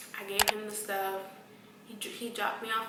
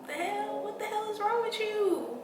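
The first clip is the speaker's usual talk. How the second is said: much higher indignant disbelief; outraged; 12 semitones higher than usual. Real, not acted. Telling viewers about the nurses, high outrage.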